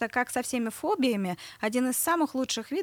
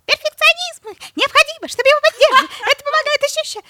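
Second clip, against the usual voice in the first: squeaky voice, much higher and louder; high piglet voice; 14 dB louder, faster than usual. Retold or imitated. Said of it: "мой голос становился на несколько октав выше".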